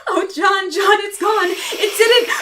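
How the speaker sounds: high, sobbing relief and fear; tearful fright. Acted. Mary to John once it's gone, tearful fright. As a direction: cry it out, shaken and tearful. Terrified woman after the attack.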